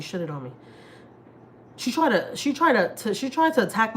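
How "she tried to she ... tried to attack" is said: "tried" pushed much higher and louder, agitated; upset outburst. Real, not acted. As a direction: blurt it out, worked up and indignant. Upset recounting attack on son.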